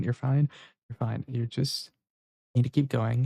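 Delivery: soothing self-reassurance. Acted. Calming herself after trying to cry, fading to a soft murmur. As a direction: talk yourself down gently.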